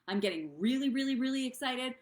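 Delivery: gushing, climbing excitement; really excited. Shown as a demonstration. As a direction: pile on the excitement. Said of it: "There's a difference".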